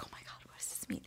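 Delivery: fast, very soft whisper; panicked whisper. Retold or imitated. Woman privately puzzling over her date's reaction, frantic whisper. Panicked inner thought after his reply.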